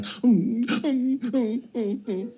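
high, soft settling hums; imitated baby whimper. Dad imitating daughter calming down, soft baby whimpers. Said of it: "you hear Faith like".

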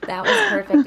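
laughing. Real, not acted. Laughs at her own yawn.

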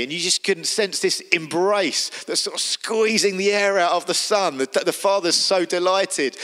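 ordinary delivery

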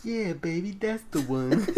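high, crooning swagger; playful swagger. Real, not acted. Proudly showing off his favorite suit, playful swagger.

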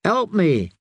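quick, pressing plea for help; urgently. A narrator's reading. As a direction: urge her to act now. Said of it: "he said urgently".

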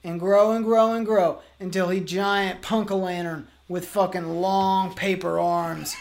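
usual speaking voice

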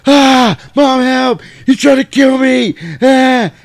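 high, loud panicked mock scream; screaming for help. Boy screams to mom that brother wants to kill him, loud high.

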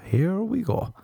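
sarcastic sing-song. Retold or imitated. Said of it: "you'd be like".

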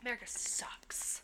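unvoiced whisper. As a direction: breathe it as a whisper. Whispered joke aside.

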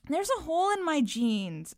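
put-on high-pitched child's whine; whining. A narrator's reading. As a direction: whine it like a complaining little kid. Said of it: "I whined".

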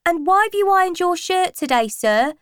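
high, fast mocking teenager; cheeky schoolboy. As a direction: tease him about the shirt. Pupil teasing the teacher.

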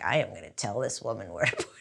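low, soft, fast grumble to herself; inner punk grumble. Retold or imitated. Cashier holds back her East Coast temper, low grumble. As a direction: swallow the retort under your breath. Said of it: "East Coast punky part of me".

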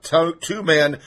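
normal talking voice

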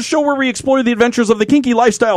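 fast, bright host welcome; show intro. Acted. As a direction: greet the audience like a host. Opens the show.

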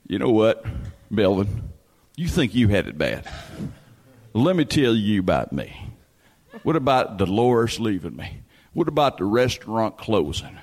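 put-on low, deliberate older smoker's voice; Uncle Smoke. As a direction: sink low and measure out each grievance. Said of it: "smoke talk like this".

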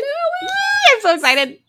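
very high squeal; excited squeal. Real, not acted. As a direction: squeal with delight. Excited about F P.

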